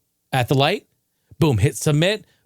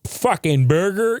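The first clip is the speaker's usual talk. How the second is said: mock mumbling customer; 5 semitones higher than usual. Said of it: "they're dealing with".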